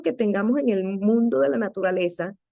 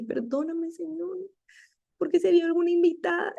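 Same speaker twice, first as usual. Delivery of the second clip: guilty pleading; 8 semitones higher than usual. Retold or imitated. Speaker retelling her apology to God for oversleeping, guilty pleading. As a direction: re-enact a small, high, remorseful plea. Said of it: "sentí culpa, horrible".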